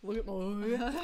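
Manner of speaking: lightly Aussie catchphrase; Australian accent. Retold or imitated. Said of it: "It's from the show".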